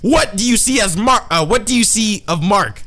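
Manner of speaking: high, loud hammed-up interrogation; mock furious demand. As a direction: demand an answer, overacting the rage. Acting father's confrontation, stumbling once.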